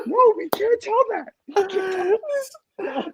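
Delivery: half-crying laughter, pleading; laughing protest. Real, not acted. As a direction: giggle out a no, begging them to stop. Begging friend not to tell.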